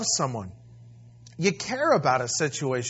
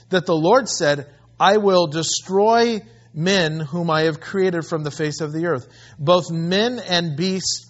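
scripture reading; 5 dB louder than usual. Acted. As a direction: proclaim the verse with weight. Preacher reading scripture aloud.

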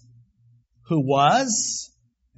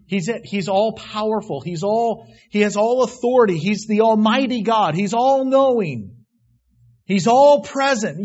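loud, slowing litany with all stressed; preaching; 5 semitones higher, 6 dB louder than usual. Acted. Preacher listing God's attributes to the congregation, loud. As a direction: lean on each all like a hammer.

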